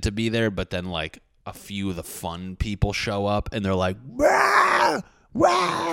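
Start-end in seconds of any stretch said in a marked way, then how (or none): 4.1-5.9 high hammed-up party roar; wild whooping party shout; mock party yell. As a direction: shout like the fun guests just burst in. Fun people arriving at party.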